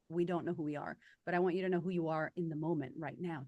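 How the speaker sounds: ordinary talk, nothing marked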